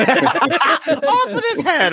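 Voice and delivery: very high, drawn-out royal decree through laughter; mock queen. Mock queen sentences the American, laughing shriek.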